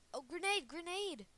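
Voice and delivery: high, loud warning shout; grenade warning yell. Real, not acted. Warning team of incoming grenade.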